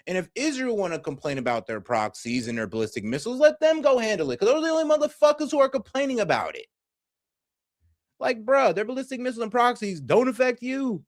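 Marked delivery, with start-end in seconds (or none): 3.4-10.3 heated rant. Real, not acted. Heated rant about Israel.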